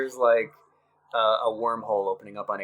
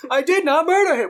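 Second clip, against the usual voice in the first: high, loud, earnest robot line; robot's earnest delivery; 7 dB louder than usual. Retold or imitated. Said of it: "the robot says it the way he actually says it".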